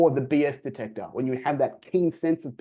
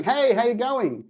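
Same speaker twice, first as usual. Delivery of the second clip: high, slow, bubbly hello; sing-song upbeat hello, pitched way up; overly cheery greeting; 10 semitones higher, 4 dB louder, slower than usual. Shown as a demonstration. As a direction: gush the greeting like a too-eager cold caller. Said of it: "coming in cheery to a cold call".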